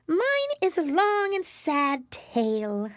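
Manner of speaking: very high, slow mouse voice; drawn-out, high-pitched woeful mouse; sighing, sad mouse. A narrator's reading. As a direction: lament it slowly in a high mouse squeak. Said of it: "turning to Alice and sighing".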